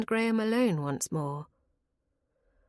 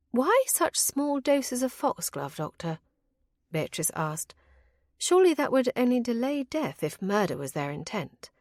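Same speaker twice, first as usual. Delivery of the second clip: Beatrice; 6 semitones higher than usual. A narrator's reading. Beatrice questions the doctor.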